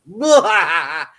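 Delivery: loud laughing scare shout of blah; hammed-up scare yell; mock monster roar. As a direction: jump out with a playful monster blah. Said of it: "and I went".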